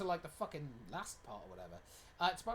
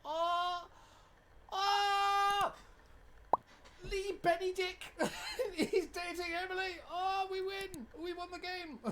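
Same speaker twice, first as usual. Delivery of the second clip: high, loud, laughing celebration; excited shouting; 7 dB louder, slower than usual. Real, not acted. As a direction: cheer like you just won. Celebrating in-game romance.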